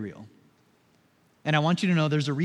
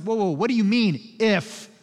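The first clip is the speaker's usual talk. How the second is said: taken-aback mock irritation; imitating someone put out; mildly irritated; 4 semitones higher, 4 dB louder, slower than usual. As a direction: bristle a little at being doubted. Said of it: "seems to irritate Jesus".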